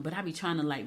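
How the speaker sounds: ordinary talk, nothing marked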